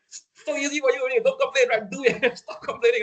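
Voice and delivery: high-pitched, exasperated scolding; worked-up uncle telling off the youngsters; nagging uncle. Acted. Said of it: "I sound like uncle now".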